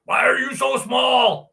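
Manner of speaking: high, hammed-up shout of complaint; joking impression of dad yelling; mock yelling. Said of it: "Probably yells at his wiener".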